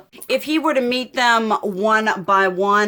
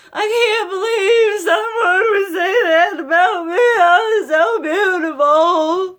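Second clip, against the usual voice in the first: tearful, voice breaking high; real tears pushing through; crying; 10 semitones higher, 5 dB louder than usual. Real, not acted. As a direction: choke up on these words. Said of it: "I'm gonna cry".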